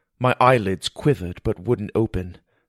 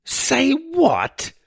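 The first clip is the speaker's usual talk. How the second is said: very high startled exclamation; shocked disbelief; 3 dB louder than usual. Acted. Boy hears of eel cure, shocked outburst. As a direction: voice shoots up in disbelief.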